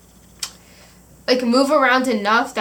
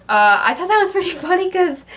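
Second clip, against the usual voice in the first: higher, quicker, laughing through the words; giggling as she recalls the sock; 8 semitones higher, 5 dB louder, faster than usual. Real, not acted. Telling viewers about the sock mix-up, laughing. Laughing at her own story.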